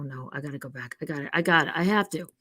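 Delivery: ordinary talk, nothing marked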